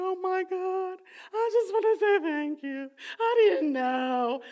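loud, high, tearful outburst, breaking into tears on the last words; retold bawling. New parent calling her parents to thank them, sobbing loudly. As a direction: sob it out loud and high, overwhelmed with gratitude. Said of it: "I mean, I was bawling".

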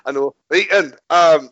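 usual speaking voice

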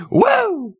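high-pitched "woo"; playful whoop. Pretend. Excited whoop after teasing co-host.

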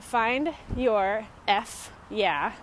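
slowly spelling out a web address; each word slow and separate; slow and deliberate. Shown as a demonstration. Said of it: "because the internet doesn't like expletives".

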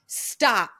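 firm retold yell of stop in a grocery store; mild mock yell. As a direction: snap stop out to cut off your thoughts. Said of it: "I yelled".

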